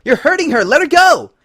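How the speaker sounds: high, loud pleading shout; frantic shout. Acted. Berry protecting Lyra from Twilight, frantic shout. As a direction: yell to make her stop.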